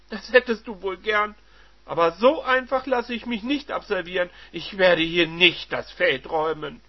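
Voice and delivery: high, loud defiance; defiant wife. Acted. Wife refusing to leave the house, loud and defiant. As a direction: plant your feet and refuse.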